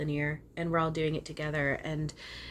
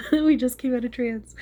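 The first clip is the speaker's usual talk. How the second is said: laughing, higher and louder; 8 semitones higher, 9 dB louder than usual. Real, not acted. Amused by her own voice, she laughs the line. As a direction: laugh it out at yourself. Laughing at herself after trance.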